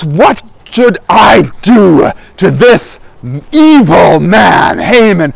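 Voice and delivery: slow, emphatic royal wrath; measured, indignant condemnation; furious king. Acted. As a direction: pronounce it slowly, leaning hard on each accusation. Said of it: "He's absolutely furious".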